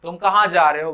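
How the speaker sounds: normal talking voice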